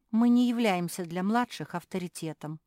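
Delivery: ordinary delivery, nothing marked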